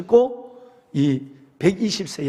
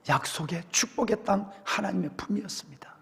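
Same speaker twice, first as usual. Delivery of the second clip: softer, whispered reverent ending; breathy whispered close; 9 dB quieter than usual. A narrator's reading. Moses telling God his years were blessed, whispered. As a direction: breathe out the words like a prayer.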